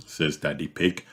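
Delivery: ordinary delivery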